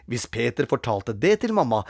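ordinary delivery, nothing marked

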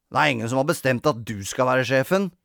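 ordinary delivery, nothing marked

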